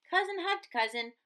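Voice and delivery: ordinary delivery, nothing marked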